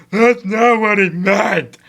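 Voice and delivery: slow, high dopey voice; mocking idiot voice. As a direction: drag it out like a dim commenter. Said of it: "some idiot's gonna say".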